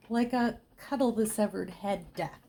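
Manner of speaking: ordinary talk, nothing marked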